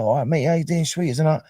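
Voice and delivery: lightly mocking Cockney mate voice; Cockney accent. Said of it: "were talking Cockney".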